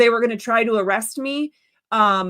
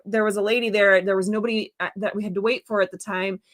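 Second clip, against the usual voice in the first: usual speaking voice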